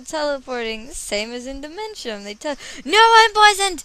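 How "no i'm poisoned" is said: loud, high-pitched outcry; dismayed shout. Real, not acted. Player finding the character poisoned, dismayed shout. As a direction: yell it in alarm at the game.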